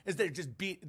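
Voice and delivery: ordinary delivery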